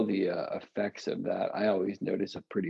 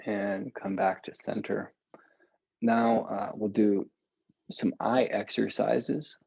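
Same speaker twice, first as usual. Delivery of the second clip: calm guided instruction. Acted. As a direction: guide each breath calmly and gently. Guides eye and neck exercises.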